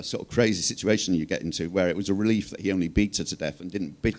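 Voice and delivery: ordinary talk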